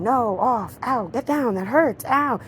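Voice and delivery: hammed-up little ouches while pushing the dog off; mock pained owner. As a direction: play the owner getting jumped on and hurt. Owner reacting to a jumping dog.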